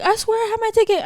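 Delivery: high-pitched insistent plea; pleading lady imitation. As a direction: imitate someone swearing to a gatekeeper. Quoting lady pleading at entrance.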